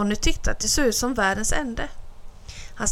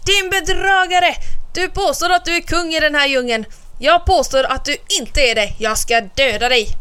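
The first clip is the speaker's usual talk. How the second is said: much higher, louder furious tiger shout; angry shout; 6 semitones higher, 4 dB louder than usual. A narrator's reading. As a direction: scream at the rival in the well. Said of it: "skrek han ilsket". (skriker ilsket)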